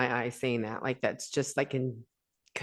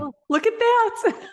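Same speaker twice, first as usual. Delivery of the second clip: much higher, louder sudden exclamation; real delighted surprise at the screen; surprised; 6 dB louder than usual. Real, not acted. Confetti appears on Zoom.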